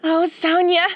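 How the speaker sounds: ordinary delivery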